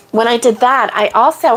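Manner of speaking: normal talking voice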